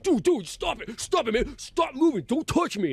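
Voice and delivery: high-pitched mock agitation; retold fussy protest, pitch way up; irritated hippie. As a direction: imitate a hippie begging someone to stop. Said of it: "that hippie guy's just".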